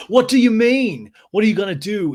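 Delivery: high, loud, stretched mock shout; James's angry challenge. Recapper imitating James confronting Schwartz, loud and heated. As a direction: imitate a man squaring up to his friend.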